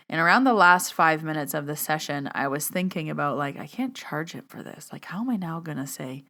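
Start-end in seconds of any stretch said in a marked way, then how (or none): 3.6-6.2 hushed inner thoughts. Retold or imitated. Inner thoughts during the session.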